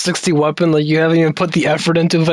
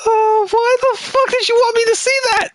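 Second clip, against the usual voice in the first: high laughing question; laughing disbelief. Real, not acted. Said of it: "do my villain laugh".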